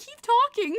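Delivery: high, loud imitation of admirers; mock an excited fan egging someone on; hammed-up eager urging. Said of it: "They're like".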